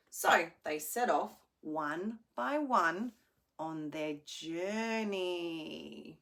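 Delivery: animated storybook narration; reading to children. A narrator's reading. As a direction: tell it like a bedtime story.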